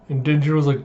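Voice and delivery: normal talking voice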